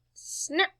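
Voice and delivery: ordinary delivery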